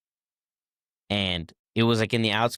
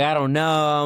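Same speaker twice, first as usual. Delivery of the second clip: imitating crying; 5 semitones higher, 5 dB louder, slower than usual. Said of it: "I'm crying, dude".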